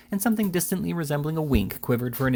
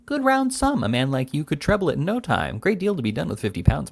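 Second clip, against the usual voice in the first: fussy little attorney; 6 semitones higher than usual. A narrator's reading. Little attorney coaxes Jingle to take the money, higher wheedling.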